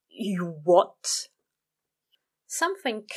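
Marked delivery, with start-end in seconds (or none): 0.1-1.3 choked, strained disbelief; strained choke. Acted. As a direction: squeeze it out, tight and strained. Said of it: "choked out a strained".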